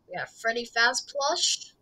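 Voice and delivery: ordinary talk, nothing marked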